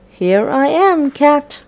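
mouse voice. Acted. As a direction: call out to the cat, taunting. Mouse teasing, repeated more boldly.

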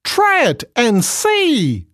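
very high, slow, teasing monkey. Acted. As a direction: coax it like a cheeky monkey. Monkey invites hippo.

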